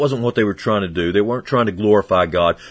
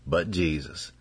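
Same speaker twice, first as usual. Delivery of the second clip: quiet repeat; 5 semitones lower, 11 dB quieter, slower than usual. Shown as a demonstration. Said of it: "I like this".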